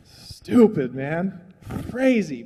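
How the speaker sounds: usual speaking voice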